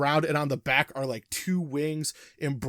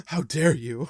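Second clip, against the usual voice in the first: mock-shocked gasp, then indignation; lightly hammed-up affront; dramatic offence. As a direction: gasp as if wounded, then protest. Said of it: "he clutches his chest very dramatically".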